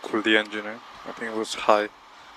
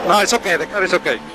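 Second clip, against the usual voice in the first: agitated; 8 semitones higher, 6 dB louder than usual. Real, not acted. Driver after crash, agitated.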